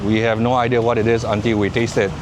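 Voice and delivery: normal talking voice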